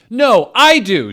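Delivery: high, very loud insistent yell; full shout. Acted. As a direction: scream it back at him.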